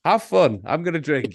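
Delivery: cheeky dismissive quote; mock sarcastic reply. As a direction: toss it off with mock cheer. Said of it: "and I'm like".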